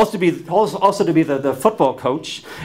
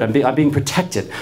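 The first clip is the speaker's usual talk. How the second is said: normal talking voice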